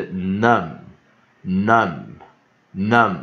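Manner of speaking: "num" slow and loud with a short U and held M; slow, punched teacher's "num"; short vowel demo. Shown as a demonstration. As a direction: drill "num" as a lone stressed syllable. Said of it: "U的短母音就是a".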